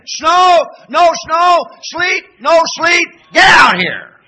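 loud, high excited shouts; 1 fervent shouts. Acted. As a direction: holler like nothing can stop you. Acting a zealous soul winner.

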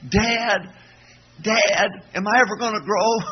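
high-pitched little son asking dad; little boy. Retold or imitated. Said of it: "Scott, little bitty thing".

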